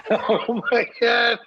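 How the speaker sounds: high, slow words through real laughter; stretched out, squealing with laughter; cracking up. Real, not acted. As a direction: laugh helplessly while saying it. Cracking up at the punchline.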